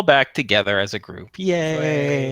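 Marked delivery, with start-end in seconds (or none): 1.4-2.3 drawn-out, higher yay; playful drawn-out cheer. Pretend. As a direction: let out a long, falling group cheer. Group cheers being back together.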